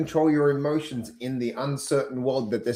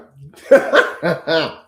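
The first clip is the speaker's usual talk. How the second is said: loud burst of laughter; big real laugh; 4 semitones higher, 9 dB louder than usual. Real, not acted. Laughs after greeting.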